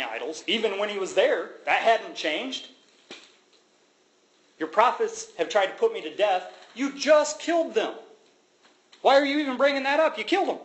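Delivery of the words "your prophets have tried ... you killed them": high, incredulous retort, louder; mock exasperated God, voice jumping up; God scolding Elijah. God scolding Elijah in a high exasperated voice.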